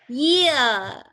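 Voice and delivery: "Year" said loud as ia, falling; teacher modelling "Year" for the class to repeat; model pronunciation. Shown as a demonstration. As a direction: say "Year" like ia, clearly. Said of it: "jadi ini bacanya adalah".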